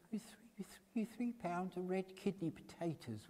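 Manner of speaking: flustered stumble over the words; stammering near-whisper. A narrator's reading. Witness stammers quietly.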